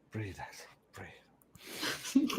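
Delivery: very soft whisper to self; hushed reminder to breathe; whispered self-calming. Real, not acted. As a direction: whisper to steady yourself after laughing. Calming self after laughing fit.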